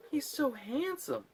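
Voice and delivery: high, slow swooning villainess; Rita Repulsa. Retold or imitated. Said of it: "Repulsa's like".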